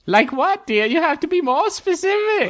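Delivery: high, fussy dwarven stallholder; high-pitched food-booth lady; dwarven vendor. Acted. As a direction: press the customer in a high character voice. Vendor character questions a picky customer.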